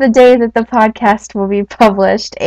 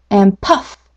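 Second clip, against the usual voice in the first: ordinary talk, nothing marked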